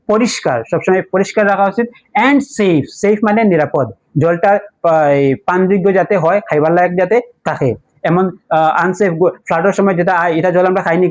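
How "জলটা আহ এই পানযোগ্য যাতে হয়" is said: usual speaking voice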